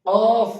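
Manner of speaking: অফ hit loud from the back of the tongue; loud back-of-throat অফ; deep k demo. Shown as a demonstration. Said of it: "উপরের তালুর সঙ্গে লাগিয়ে উচ্চারিত হবে".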